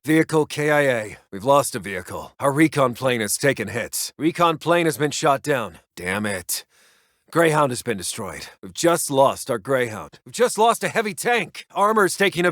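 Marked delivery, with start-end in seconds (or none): 5.9-6.6 low, soft curse under the breath; muttered curse. Acted. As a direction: swear quietly to yourself at the loss. Cursing a downed plane.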